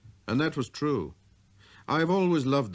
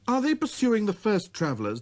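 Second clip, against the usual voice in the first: put-on high, light boyish voice; a small boy's clear greeting; little prince voice; 11 semitones higher, 4 dB louder than usual. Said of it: "demanded the little prince".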